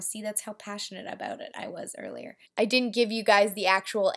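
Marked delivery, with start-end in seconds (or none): 0.0-2.4 much softer calm aside; quiet aside. Real, not acted. Admitting to viewers she yelled, much softer. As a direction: drop right down, almost to yourself.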